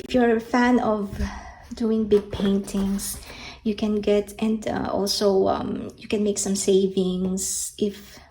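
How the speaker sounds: ordinary talk, nothing marked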